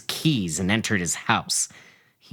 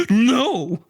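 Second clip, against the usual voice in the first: high, loud shocked No; aghast cry; horrified gasp; 13 semitones higher, 5 dB louder than usual. Acted. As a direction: gasp it out in disbelief. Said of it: "he gasped".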